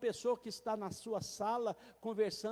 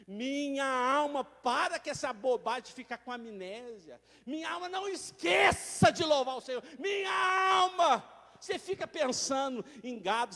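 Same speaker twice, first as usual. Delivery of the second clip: loud fervent rebuke of the soul; impassioned sermon voice; preaching at full force; 7 dB louder than usual. Acted. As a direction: preach hard at your own soul.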